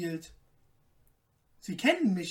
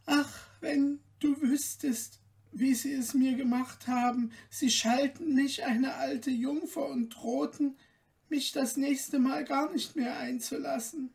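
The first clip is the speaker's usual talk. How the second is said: put-on lightly raised, gently pleading girl's voice; young daughter's voice; 6 semitones higher than usual. Märchen complains to the queen.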